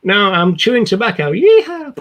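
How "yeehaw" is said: high, loud hammed-up cowboy yeehaw; mock cowboy whoop. Joke about chewing tobacco.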